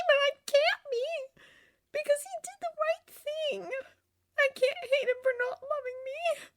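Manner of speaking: high tearful wail; high-pitched crying lament; whimpering. A narrator's reading. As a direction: sob out the words in a high voice. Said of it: "she whimpered".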